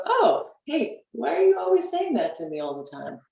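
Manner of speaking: high, teasing question to the inner critic; playful challenge. Pretend. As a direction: call out the judgment voice with a light tease. Talking back to judgment voice.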